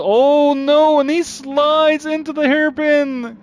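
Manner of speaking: sudden shock at the slide; alarmed shout. Real, not acted. Car slides into hairpin.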